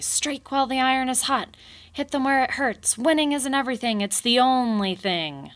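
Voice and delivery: high, loud battle speech; rallying shout. Acted. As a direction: pump up the troops, loud. Kanad rallying beavers.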